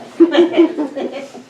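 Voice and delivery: a quick real laugh; brief chuckle; short laugh. Real, not acted. Laugh before scene change.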